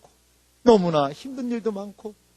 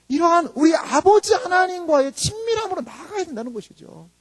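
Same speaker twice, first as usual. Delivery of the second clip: ordinary talk, nothing marked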